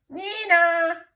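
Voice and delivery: high, loud two-tone siren; police siren. A narrator's reading. Teacher reads of cops arriving, imitated police siren. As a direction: wail it like a siren.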